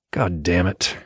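low mutter through clenched lips; mumbled curse. A narrator's reading. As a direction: curse under your breath, lips tight. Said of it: "mumbled through clenched lips".

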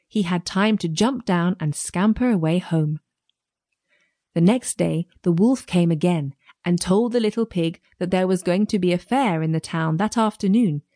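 plain reading voice

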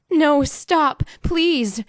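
high-pitched desperate plea; desperate pleading. A narrator's reading. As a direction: beg him to stop. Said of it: "her mother pleaded".

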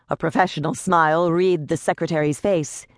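usual speaking voice

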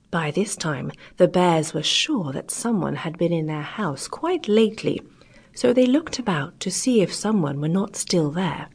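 ordinary delivery

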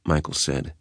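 ordinary delivery

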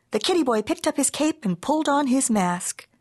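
ordinary delivery

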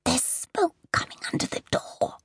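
high breathless whisper of a medium in trance; frightened whisper. Acted. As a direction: whisper as if hearing the fire close by. Medium in trance senses fire.

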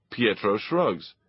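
usual speaking voice

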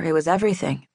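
plain reading voice

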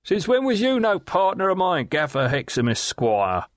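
high, sly leering call across the water; leering waterman. Acted. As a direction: Riderhood hailing Gaffer with a sly leer, pitched high. Riderhood's voice held.

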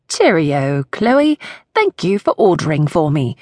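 chirpy fake British accent, badly done. Everly thanks Chloe in a hammed-up fake British accent. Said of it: "the worst attempt at a British accent".

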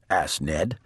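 usual speaking voice

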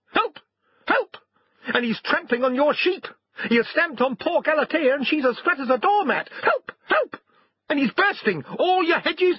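very high squeals broken by cries for help; frantic little dog pleading with its master; alarmed talking dog. A narrator's reading.

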